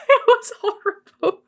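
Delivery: laughing through tears; laughing near tears. Real, not acted. Recalling Benjamin Button, laughing through real tears. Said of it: "You can hear it in my voice".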